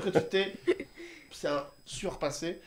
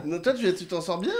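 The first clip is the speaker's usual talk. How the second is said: laughing; 4 semitones higher, 11 dB louder than usual. Real, not acted. Laughing at the clip.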